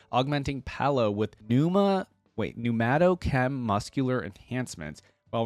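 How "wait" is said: ordinary delivery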